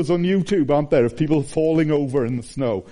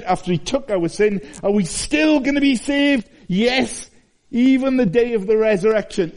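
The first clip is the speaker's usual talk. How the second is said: preaching at full force; 6 semitones higher than usual. Acted. Preacher to the congregation about the risen king, impassioned preaching. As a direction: build it up and preach out.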